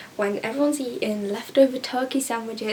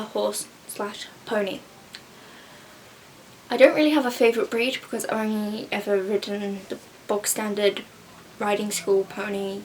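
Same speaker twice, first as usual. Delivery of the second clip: usual speaking voice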